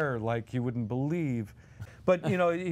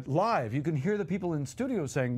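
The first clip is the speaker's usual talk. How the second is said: usual speaking voice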